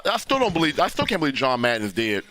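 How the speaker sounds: ordinary delivery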